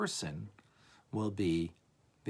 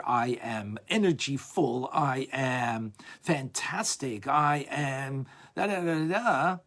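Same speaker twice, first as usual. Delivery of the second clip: slow, louder, higher hammed-up self-praise; preening self-admirer; 4 semitones higher, 6 dB louder, slower than usual. Teacher mocks a person in love with himself, hammed-up. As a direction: puff up and savor every boast.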